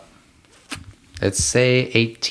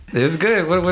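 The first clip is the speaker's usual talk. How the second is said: high, laughing through the words; 7 semitones higher than usual. Real, not acted. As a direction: giggle as you say it. Laughing at joke.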